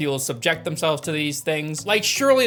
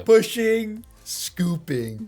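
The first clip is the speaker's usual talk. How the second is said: slowed, sing-song instructor cue; a hint of a yoga instructor; mock yoga cue; 5 semitones higher, much slower than usual. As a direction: playfully mimic the yoga teacher. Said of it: "this is yoga I can do".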